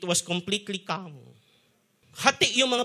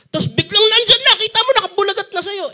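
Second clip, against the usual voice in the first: sudden burst, higher and louder; alarmed telling; 14 semitones higher, 9 dB louder than usual. Retold or imitated. Preacher reliving the near crash, alarmed and loud. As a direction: blurt out the shock of the crash.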